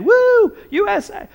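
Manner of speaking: high, loud stadium whoop; imitating a fan's loud cheer. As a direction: cheer it like a fan in the stands. Fans cheering at the Olympics.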